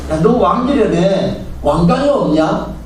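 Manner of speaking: high, slow, sneering jeer at a captive king; mocking taunt. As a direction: taunt him, drawing the words out with scorn. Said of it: "이러면서 조롱하면서". (높고 느리게 비웃는 조롱조)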